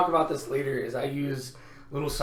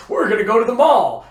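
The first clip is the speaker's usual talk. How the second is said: loud actor voice; 7 semitones higher, 10 dB louder than usual. Retold or imitated. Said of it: "And they're like".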